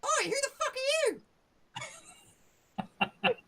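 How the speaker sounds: brash goblin challenging strangers at the bridge. Shown as a demonstration. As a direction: squeak it out like a rude little goblin guard. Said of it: "So he's like".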